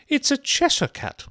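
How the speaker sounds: very high, loud Duchess voice; loud, high-pitched character voice; brusque Duchess. A narrator's reading. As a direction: squawk the answer imperiously. Duchess snaps an answer at Alice.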